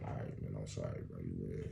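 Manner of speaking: low, soft defeated mumble; mock cowed apology; meek surrender. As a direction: back down quietly, beaten. Giving up to threatening guard.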